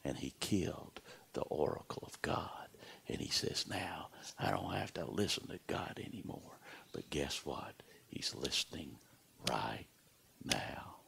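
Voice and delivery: whispered, breathy warning; hushed whisper. Acted. Preacher whispering the warning to his congregation, hushed. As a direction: drop to a whisper as if sharing a secret.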